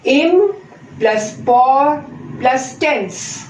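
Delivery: slow, syllable by syllable: Im, por, tense; teacher chopping a word into syllables; broken into syllables. Shown as a demonstration. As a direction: spell out Im plus por plus tense slowly, beat by beat. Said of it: "you can break it up into three syllables".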